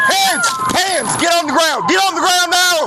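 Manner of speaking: sharp, high shouted police commands; shouted surrender demands. Real, not acted. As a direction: bark the orders at full volume. Officer forcing suspect to ground.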